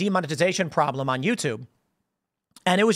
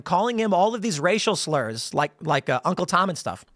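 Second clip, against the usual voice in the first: normal talking voice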